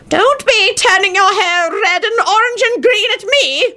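very high, slow scolding; high-pitched old wizard's mock shout; shouting old man. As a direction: scold indignantly without real volume. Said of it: "shouted John".

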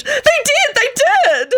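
extremely high hysterical squeal; shrieking high protest; screamed. A narrator's reading. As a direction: squeal it insistently, pitch at the very top. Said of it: "Antonin screamed".

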